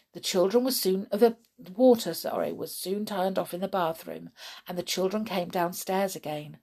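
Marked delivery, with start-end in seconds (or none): none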